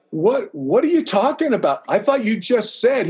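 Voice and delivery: high-pitched, baffled comeback; incredulous protest. Retold or imitated. Candidate questioning his friend's sudden refusal, incredulous. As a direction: imitate a bewildered objection, pitched well up.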